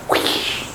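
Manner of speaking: voiceless swishing noise; swimming whoosh. Pretend. Teacher showing how fish swim, swimming whoosh.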